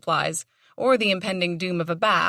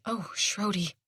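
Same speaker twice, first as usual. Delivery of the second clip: soft dismayed cry, much softer; a hushed cry of dismay; pained cry at the dog's wound; 6 dB quieter, slower than usual. A narrator's reading. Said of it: "The cry escaped her".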